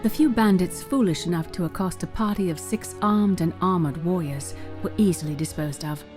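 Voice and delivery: usual speaking voice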